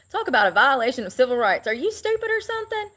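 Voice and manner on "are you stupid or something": high, scornful put-on voice; mocking retort. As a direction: sneer it in a much higher voice. Mocking the Walmart manager.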